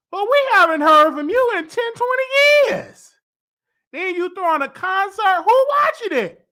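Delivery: put-on high and loud, drawn out, girl where you been; incredulous calling out. Host calling out [name] over her long absence, incredulous and loud. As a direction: call her out, teasing and loud. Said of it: "And I was like".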